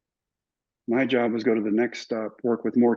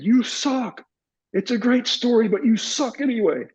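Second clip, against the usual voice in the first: mock heckler; 12 semitones higher than usual. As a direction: play the taunting fan, pitched way up. Retold heckle from stands.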